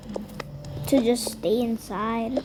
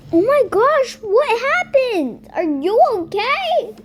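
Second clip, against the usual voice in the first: high, loud panicked concern; loud fretting over a hurt kid; alarmed; 9 semitones higher, 7 dB louder than usual. Acted. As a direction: rush in worried. Alarmed parent rushing in.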